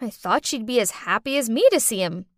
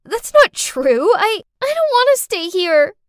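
shaken protest; 9 semitones higher, 8 dB louder than usual. Acted. Cindy to Ethan, a high upset denial. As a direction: protest in shock. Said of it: "I muttered out".

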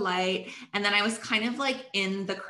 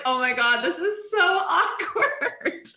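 embarrassed laugh; 7 semitones higher, 4 dB louder than usual. Retold or imitated.

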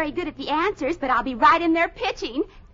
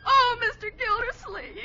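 high, slow comic wail; crying; 6 semitones higher than usual. Acted. Bessie breaks down in front of her employer, crying. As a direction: wail tearfully through the name. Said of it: "Why are you crying?".

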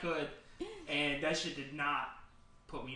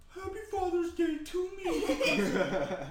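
high, slow, put-on mocking voice; hammed-up mocking mom voice; mock single mother; 13 semitones higher, 3 dB louder, slower than usual. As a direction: ham up a bitter mom's post. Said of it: "every single mother out there".

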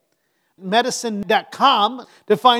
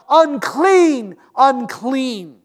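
raised, drawn-out cry of warning; 3 semitones higher, 5 dB louder, much slower than usual. Acted. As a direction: call it out like a leper warning others away. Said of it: "and cry out".

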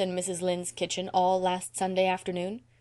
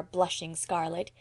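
plain reading voice

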